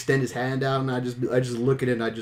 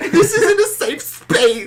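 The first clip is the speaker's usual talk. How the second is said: very high, slow, squeaky whine; mock whiny principal; 8 dB louder, much slower than usual. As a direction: drag it out like a crybaby. Mocking principal voice.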